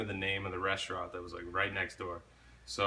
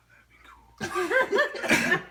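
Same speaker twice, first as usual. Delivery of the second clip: laughing whisper; 5 dB louder, much slower than usual. Retold or imitated.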